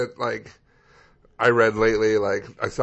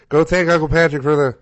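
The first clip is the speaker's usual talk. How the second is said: loud, raised-pitch parental order; parent's loud prompting; 6 semitones higher, 11 dB louder than usual. Retold or imitated. Friend telling his kids to thank their uncle, loud prompting. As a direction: call out instructions to the kids.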